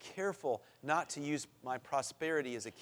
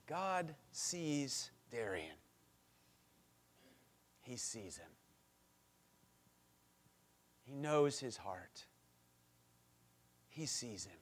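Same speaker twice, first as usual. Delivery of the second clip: tender; 3 semitones lower, 8 dB quieter, slower than usual. Real, not acted. Tender close after the story.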